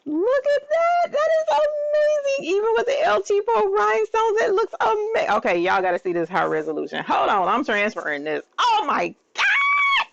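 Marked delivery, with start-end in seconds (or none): 0.1-5.4 high, gushing squeals; overwhelmed, squealing excitement; fangirling. Real, not acted. 8.6-10.1 long, very high shriek; so excited. Real, not acted. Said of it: "I am so excited".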